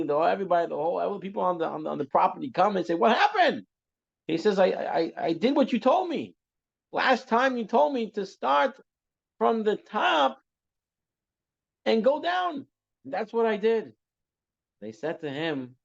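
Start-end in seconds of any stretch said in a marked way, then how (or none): 2.9-3.7 high, loud acted-out shout; alarmed question. Whole household rushes to the well, high alarmed shout. Crowd comes running to the well. 4.6-13.9 high, insistent acted self-defence; indignant protest. Servant in the well defends himself, high insistent protest.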